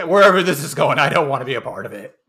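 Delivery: loud mock protest from a wife; loud, emphatic quoted objection; imitated wife's refusal.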